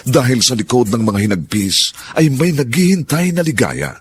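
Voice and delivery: sweeping, emotive narrator read; dramatic radio narration. Acted. Narrator introduces the drama's theme over music, sweeping and emotive. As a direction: build hope through the speech.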